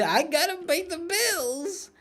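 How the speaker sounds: high, drawn-out comic whine; mock pleading. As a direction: plead your excuse in a squeaky put-on voice. Mock excuse for pushing gambling.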